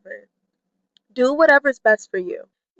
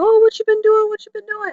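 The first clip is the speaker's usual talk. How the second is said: high-pitched imitation of curious people; mock-curious, pitched way up; nosy acquaintances; 11 semitones higher, 4 dB louder than usual. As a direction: put on a high voice, mimicking eager questioners. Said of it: "people ask you".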